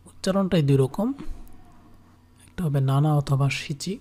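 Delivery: ordinary talk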